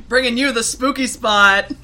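loud, hammed-up radio announcer. Host doing a stupid show opening for co-host, radio voice. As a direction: belt out a silly station ident like a DJ. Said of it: "you don't like the radio voice".

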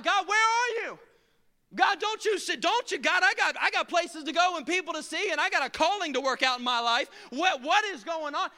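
mock frustrated prayer; whining at God. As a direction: demand answers from God. Complaining to God.

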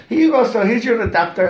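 high receptionist voice. Retold or imitated. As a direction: play the hotel receptionist, high and polite.

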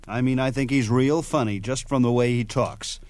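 ordinary delivery

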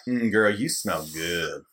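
low, slow creepy come-on; creepy dude. Retold or imitated. Friends joking about a creepy stranger, slow sleazy low voice. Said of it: "Was he like".

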